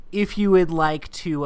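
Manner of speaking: usual speaking voice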